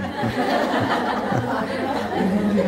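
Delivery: warm hearty laugh at the story; amused laugh. Real, not acted. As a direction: laugh out at the absurdity.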